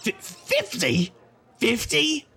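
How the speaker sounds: stammered, then high outraged squeak; indignant disbelief. Acted. Uncle insulted by younger self, high outraged squeak. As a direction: repeat the number in shocked offence. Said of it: "Oh my God, try thirty".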